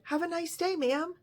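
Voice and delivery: high, sugary customer-service voice; sweet cashier goodbye. Retold or imitated. Stephanie at the register bidding a rude customer farewell, high and sweet.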